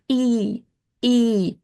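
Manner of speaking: isolated "e", drawn out as ee; default vowel form. Shown as a demonstration. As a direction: hold "e" as an unhardened ee. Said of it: "in its default form".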